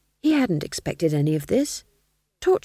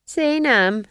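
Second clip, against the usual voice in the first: lightly voiced witch, higher and louder; Nanny's character voice; 7 semitones higher, 5 dB louder than usual. A narrator's reading. Witch prisoner answering Duchess, lightly voiced role. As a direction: brush off the threat, higher. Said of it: "said Nanny".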